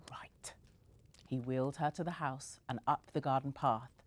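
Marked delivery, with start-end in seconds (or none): 0.1-0.5 menacing whisper. A narrator's reading. Sam vowing revenge.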